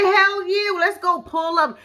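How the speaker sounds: hyped up. Shown as a demonstration. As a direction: pump it up like a hype girl. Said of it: "put some more bass behind your voice".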